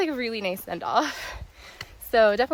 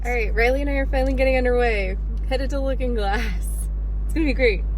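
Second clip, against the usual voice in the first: loud, upbeat kickoff to the hike; cheerful and loud, raring to go; excited; 2 semitones higher, 7 dB louder than usual. Real, not acted.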